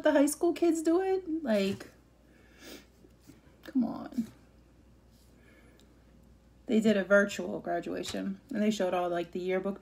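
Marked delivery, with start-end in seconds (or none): 1.4-4.3 breathy whispered come on; exasperated whisper. Real, not acted. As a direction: let the frustration slip out in a whisper. Exasperated complaint about school.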